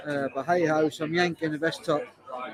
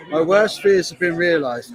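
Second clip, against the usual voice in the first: normal talking voice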